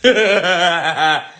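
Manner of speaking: short rattling nervous laugh. Real, not acted. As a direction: laugh off the nerves. Nervous before opening the box.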